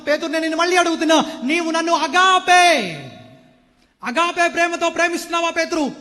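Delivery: Jesus pressing Peter, stretching the word agape; Jesus pleading. Acted. Jesus asks Peter again, loud and pleading. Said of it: "అని అడిగాడు".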